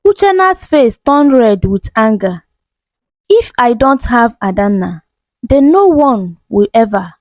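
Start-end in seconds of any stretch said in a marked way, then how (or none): none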